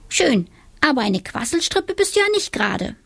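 high, mocking witch voice; teasing little witch. A narrator's reading. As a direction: tease the boy with a grin. Witch teasing the quiet boy.

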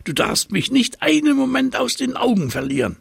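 very high, fast monkey chatter. A narrator's reading. Monkey hopping about, urging the giraffe to watch, fast and high. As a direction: rattle it off high and quick.

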